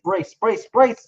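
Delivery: high, loud shouts of brace; bracing for a crash. Acted. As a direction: yell brace at the cabin. Crash, yelling brace again.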